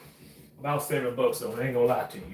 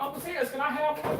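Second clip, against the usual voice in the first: put-on high, quick little-girl voice asking for a slice; kid niece asking; 12 semitones higher, 6 dB quieter, faster than usual. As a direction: play the young niece Kelsey begging sweetly. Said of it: "Kelsey was here right now and be like".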